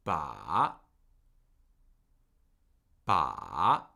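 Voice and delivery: "Ba" dipping very low, then rising; low fall-rise on Ba; third tone. Shown as a demonstration. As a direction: sink deep on "Ba" and swing back up. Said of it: "with a third tone".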